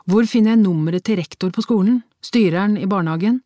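brisk practical friend. A narrator's reading. Friend offering to ring the school, brisk and practical. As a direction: take charge with practical questions.

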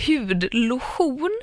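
"hudlotion" with lotion as Swedish lo-SCHON; Swedish-style "lotion". Retold or imitated. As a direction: drag out hudlotion, ending in a stressed -schon. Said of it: "varför säger ni lotion". (försvenskat uttal av "hudlotion")

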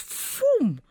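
high, swooping "phoom"; a sudden rocket-like whoosh noise; whoosh sound effect. Shown as a demonstration. Imitates a song taking off.

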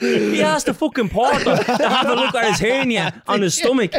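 high, incredulous and half laughing; high-pitched amused disbelief. Retold or imitated. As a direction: retell the outrage through laughter, pitch climbing. Incredulous retelling of mistaken porter.

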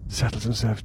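ordinary talk, nothing marked

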